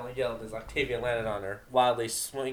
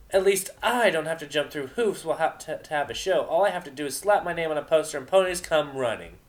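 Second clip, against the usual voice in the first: loud, high brag; cocky shouted boast; boastful; 6 semitones higher, 6 dB louder than usual. Acted. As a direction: rub it in her face. Vinyl boasting loudly.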